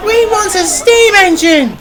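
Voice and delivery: put-on very high, drawn-out child voices; squeaky children's chant; shouting children. A narrator's reading. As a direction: call it out slowly like demanding kids. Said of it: "shouted some children".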